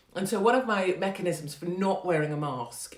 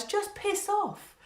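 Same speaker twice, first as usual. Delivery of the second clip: slow dismissive piss off; much slower than usual. Real, not acted. Rant at critic.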